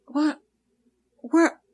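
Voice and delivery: puzzled, trying to make sense of it; confused. Retold or imitated. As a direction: retell it as mild bewilderment. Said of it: "we were confused for a minute".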